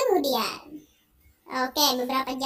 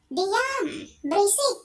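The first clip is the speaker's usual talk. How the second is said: high, sharp furious order; angry shout; 6 semitones higher, slower than usual. Acted. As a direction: shut her up with a yell. Husband voiced yelling at wife.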